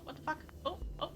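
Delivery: ordinary talk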